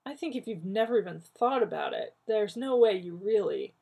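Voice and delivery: ordinary delivery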